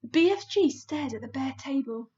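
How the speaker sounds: ordinary delivery, nothing marked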